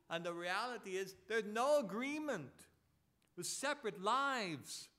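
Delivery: high, emphatic preacher's proclamation; forceful preaching. Real, not acted. Preacher telling believers they are the temple, forceful preaching. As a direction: proclaim it, leaning hard on key words.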